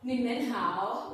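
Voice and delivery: ni men hao said slowly, rising at the end; slow, careful ni men hao; greeting the class with a slow ni men hao; Chinese greeting. Shown as a demonstration. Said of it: "it becomes hello to you all".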